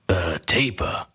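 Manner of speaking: "Atepa" whispered with no voice, rising at the end; rasped whisper. Acted. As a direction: breathe "Atepa" out like a weak, worn-out man. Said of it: "I rasped out".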